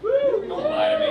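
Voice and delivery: high, loud, drawn-out call to the crowd; playful shout. Real, not acted. Comic calling out to a crowd that stays silent, shouted high and slow. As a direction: bellow it at the audience, teasing.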